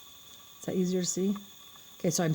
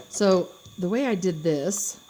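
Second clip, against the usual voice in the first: ordinary delivery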